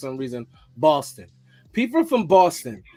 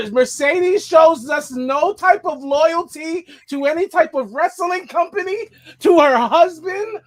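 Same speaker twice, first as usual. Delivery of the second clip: slow, louder and higher, building mock outrage; mock outrage rant; hammed-up rant; 8 semitones higher, 6 dB louder than usual. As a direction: preach each grievance slowly. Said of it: "What is wrong with you?".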